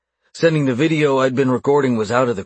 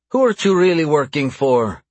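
louder, higher interrogating question; insistent demand; 5 semitones higher, 5 dB louder than usual. A narrator's reading. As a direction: press hard for the real answer. Captor interrogates his captive.

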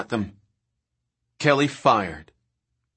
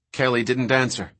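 usual speaking voice

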